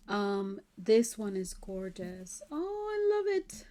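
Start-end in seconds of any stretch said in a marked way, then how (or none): 2.5-3.6 a high, delighted squeal of love. Real, not acted. Unboxing a kit for viewers, squealing with delight. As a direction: gush over it like a squealing fan.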